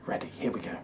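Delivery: whispering. Real, not acted. Whispered cue before playing.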